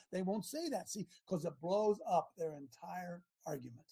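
ordinary talk, nothing marked

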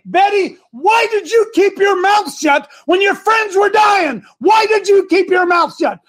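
high, loud, slow accusing voice of God; God confronting Betty before the throne; the Lord's judgement. Acted. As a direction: call her to account from the throne. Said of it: "and he's gonna say".

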